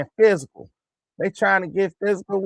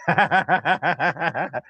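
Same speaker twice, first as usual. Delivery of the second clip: rapid chuckling laughter. Real, not acted. Laughing at his own go-home taunt, rapid chuckles. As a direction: crack up in a long run of chuckles.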